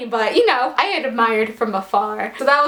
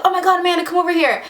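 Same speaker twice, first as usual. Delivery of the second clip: higher-pitched friend beckoning; imitated calling out; 6 semitones higher, much faster than usual. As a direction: lightly act out a friend calling you over. Voices friend calling her over.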